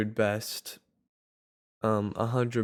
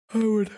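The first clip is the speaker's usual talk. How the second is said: exasperated sigh; 12 semitones higher, 5 dB louder than usual. Real, not acted. Frustrated high exclamation and sigh.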